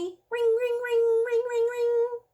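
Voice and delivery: high sing-song ring ring; bell ringing. Shown as a demonstration. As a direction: chime like a school bell with the kids. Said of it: "Can you ring with me?".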